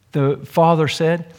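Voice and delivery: ordinary talk, nothing marked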